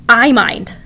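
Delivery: loud firm I mind; exclaim. A narrator's reading. Narrator objecting to Kavita's I don't mind. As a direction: snap it back loudly. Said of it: "I exclaim".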